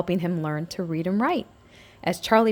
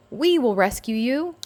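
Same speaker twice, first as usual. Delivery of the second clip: mild shout; 5 semitones higher than usual. A narrator's reading. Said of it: "Little N shouted".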